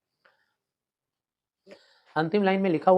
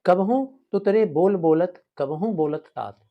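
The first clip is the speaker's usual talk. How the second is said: verse recitation; 3 semitones higher, 7 dB louder, slower than usual. Shown as a demonstration. Teacher recites poem lines.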